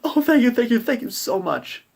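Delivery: high, relieved, grateful mother. Acted. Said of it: "And the mother's like".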